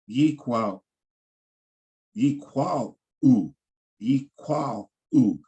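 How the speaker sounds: yikwal u said slowly, syllable by syllable, stress on the final u; slow pronunciation demo. Shown as a demonstration. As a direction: spell out yikwal u slowly for learners. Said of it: "The way you pronounce that".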